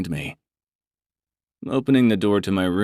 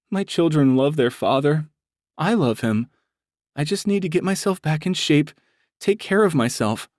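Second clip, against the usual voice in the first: higher, pleading woman's voice; mildly upset; 9 semitones higher than usual. Acted. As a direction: defend your choice a little tearfully. Brandy justifying herself.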